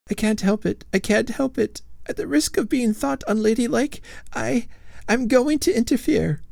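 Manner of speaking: high, fretful ghost lady voice; a prim lady ghost fussing at her husband; ghostly old lady. A narrator's reading. Lady Follett's voice.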